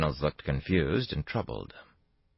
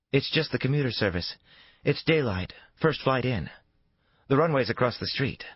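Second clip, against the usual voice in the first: a higher female voice for Faith, matter-of-fact; Faith's voice; 10 semitones higher than usual. A narrator's reading. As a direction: voice Faith explaining the early flight.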